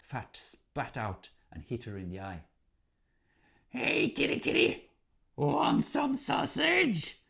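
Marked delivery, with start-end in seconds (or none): 3.7-7.2 very high, slow teasing call; high troll child luring the cat; mocking troll child. A narrator's reading. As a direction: taunt the cat in a squeaky sing-song. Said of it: "the troll screamed".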